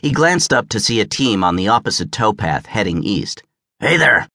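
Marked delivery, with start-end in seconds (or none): 3.8-4.3 driver's call, pitched up; lightly acted hoarse holler; hoarse yell. A narrator's reading. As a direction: hail someone across the canal, moderately raised voice. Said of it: "yelled the driver in a hoarse voice".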